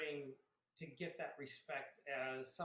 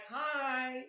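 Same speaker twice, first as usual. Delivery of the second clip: put-on cheerful little girl; 8 semitones higher, 8 dB louder than usual. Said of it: "they're like".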